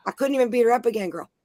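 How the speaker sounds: usual speaking voice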